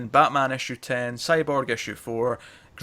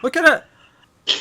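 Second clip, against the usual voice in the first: excited; 7 dB louder than usual. Real, not acted. Gawking at the giant moth.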